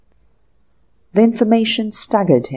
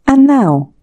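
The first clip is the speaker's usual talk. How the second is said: almost genial; 7 semitones higher, 8 dB louder than usual. Acted. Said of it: "said the other almost genially".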